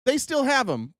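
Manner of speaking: airy promise in a lightly put-on voice; a hint of a breezy official; mocking Pam Bondi. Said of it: "And then she's like".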